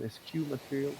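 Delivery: "Eschew" said as es-kyoo, softly; questioned pronunciation. Shown as a demonstration. Asking the table to check the word, "Eschew" tentatively pronounced es-kyoo. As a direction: offer "Eschew" with a k sound, es-KYOO. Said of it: "is that the correct pronunciation".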